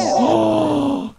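high, sharp gasp; amazed gasp. Pretend. Host hearing the guest's tour announcement, gasping in amazement. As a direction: gasp at huge news.

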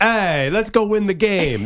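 high, hammed-up Jersey Italian delivery; mock Italian-American accent. Shown as a demonstration. Host showing how the quarterback should say it, hammed-up Italian accent. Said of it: "That's how you do it".

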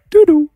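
high two-note phone chime; imitated phone chime. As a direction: sing the iPhone beep. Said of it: "a little iPhone sound".

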